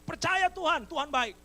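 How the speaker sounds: high, loud, emphatic reply; eager shouted answer. Acted. Hanging man answers God that he believes, loud and eager. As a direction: shout your belief straight back.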